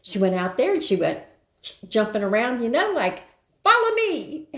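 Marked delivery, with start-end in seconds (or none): 3.6-4.5 high-pitched dog calling follow me; Lassie voice. Retold or imitated. Sitter voicing the little shepherd like Lassie, very high. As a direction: squeak it out like Lassie. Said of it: "like Lassie".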